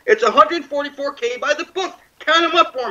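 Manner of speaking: laughing. Real, not acted. Mocking viewers with a laugh, repeating the number. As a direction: scoff through a laugh.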